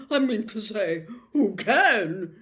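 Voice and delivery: nervous protest. A narrator's reading. Sweating Fudge pleads.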